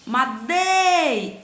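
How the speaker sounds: "Madei" with "dei" drawn out slowly; stretched tonic syllable. Shown as a demonstration. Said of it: "sílaba que você passa mais tempo". (chamando "Madei", com o "dei" alongado)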